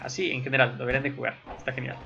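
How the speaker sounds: ordinary talk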